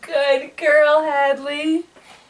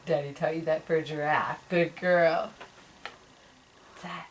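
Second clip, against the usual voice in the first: ordinary talk, nothing marked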